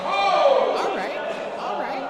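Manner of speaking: excited. Real, not acted. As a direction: burst out at the big moment. Commentator thrilled at final exchange.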